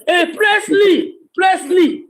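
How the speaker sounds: high shout across the room; shouted call. Retold or imitated. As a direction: yell the name up high to get attention. Calling out the name Presley.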